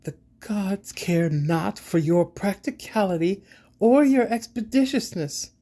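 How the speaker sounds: high-pitched seething righteous anger. A narrator's reading. Siba rebuking you in the gods' name, seething. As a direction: declaim it, seething, voice climbing high. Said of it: "she seethes".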